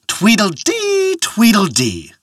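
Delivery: singsong bagpipe imitation, high drawn-out first, dropping on the second; bagpipe tune. A narrator's reading. Man playing the bagpipes for a following crowd, singsong imitation. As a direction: voice the piper's tweedling, stretched high then falling. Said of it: "a man playing the bagpipes".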